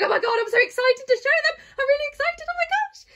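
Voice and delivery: high-pitched, gushing re-enactment of being thrilled; acting out over-excited, out-of-breath gushing; breathless excitement. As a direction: squeal it quickly, imitating your own giddy excitement. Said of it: "I was getting out of breath because I was like".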